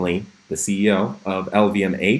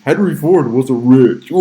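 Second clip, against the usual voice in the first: loud, higher mock replay of a slip; mock meme replay; 4 semitones higher, 7 dB louder than usual. Streamer parodies own slip as a meme, loud mocking replay. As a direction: blurt it out big like a meme clip. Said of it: "turned that into a meme".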